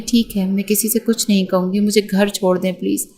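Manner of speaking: usual speaking voice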